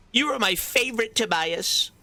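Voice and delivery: high, far put-on voice, sung out; sing-song high greeting. Streamer crooning to a favourite viewer, high sing-song.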